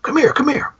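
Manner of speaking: put-on high, hushed beckoning old man; a creepy old John Carradine calling the boys over; creepy old farmer. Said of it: "John Carradine".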